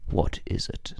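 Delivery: low, soft, lazy whispered question; a hushed, bored star being fed grapes; whispered Tom Cruise impression. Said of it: "That's my Tom Cruise impression".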